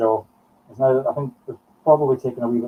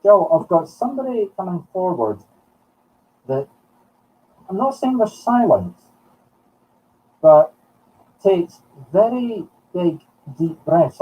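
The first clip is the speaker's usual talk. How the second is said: usual speaking voice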